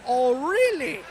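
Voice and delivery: a hint of a German accent, mock offended; lightly voiced German, sniffy. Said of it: "the guy got offended and said".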